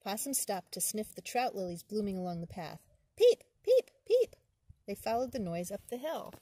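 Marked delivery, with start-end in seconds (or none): 3.2-4.4 high, loud piping peeps; high frog peep. Acted. The spring peeper calling in the woods, high and loud. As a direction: chirp it like a tiny frog.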